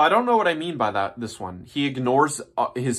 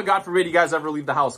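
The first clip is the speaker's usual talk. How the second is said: normal talking voice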